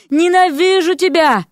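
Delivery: high, slow, stretched-out yell; wailing yell. A narrator's reading. Vasya bouncing on Erik's shoulder, yelling hatred. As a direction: shriek it, stretching the vowels. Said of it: "вопила она".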